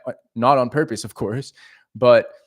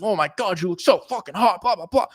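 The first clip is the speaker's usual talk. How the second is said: high, worked-up mock gushing, almost mad; hammed-up pushy compliment; overly aggressive; 6 semitones higher than usual. Shown as a demonstration. Coach mocking an overeager approach to a girl, overly aggressive. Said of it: "overly fucking aggressive".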